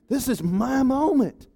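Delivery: gloating Haman. Acted. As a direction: draw out the words like a proud man. Haman savouring his moment.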